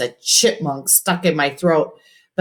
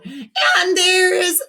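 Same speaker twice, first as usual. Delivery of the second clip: straining whine; 3 dB louder than usual. A narrator's reading. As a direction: squeeze the words out. Robert straining for answers.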